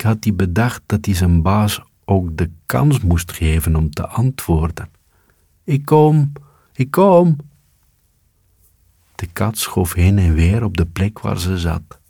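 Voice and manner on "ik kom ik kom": much higher small voice calling back; high answering voice. A narrator's reading. The dog's master answering its call, high-pitched. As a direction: answer from far away.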